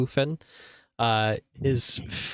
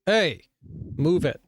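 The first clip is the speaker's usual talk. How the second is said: mildly barked order from a grumpy guard; gruff guard; 4 semitones higher, faster than usual. Acted. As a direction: shove him off with a grumble. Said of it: "and he goes".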